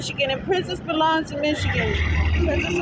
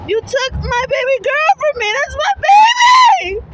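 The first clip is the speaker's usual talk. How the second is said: anguished wail; 6 semitones higher, 5 dB louder than usual. Real, not acted. Mother crying out for the baby taken from her, anguished scream. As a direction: scream it out in grief.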